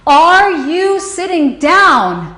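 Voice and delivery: high, loud, slow mock shriek; retold shriek. Mother shrieking down the phone at her daughter. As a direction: stretch it out like a garbled shriek down the phone. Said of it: "these garbled words shrieked out".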